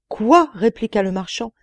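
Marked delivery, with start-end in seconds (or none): 0.1-0.5 high startled cry; shocked quoi. A narrator's reading. Merchant stunned by the genie's cruelty, startled cry. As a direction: gasp it out in disbelief.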